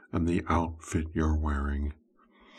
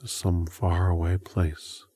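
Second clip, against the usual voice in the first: hypnotic whisper induction. Acted.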